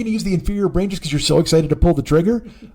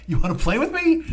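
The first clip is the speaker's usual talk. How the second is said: put-on higher, childlike invitation; little girl; 7 semitones higher than usual. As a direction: lightly mimic a little girl asking to play. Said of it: "the girl's just like".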